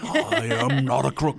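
solemn mock denial; Nixon impression. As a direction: drag it out like a grumbling president. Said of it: "they got the hands going and everything".

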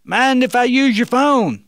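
high, loud call to the back room; yelling out. Acted. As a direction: shout the question across the shop. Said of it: "the young man yelled out again".

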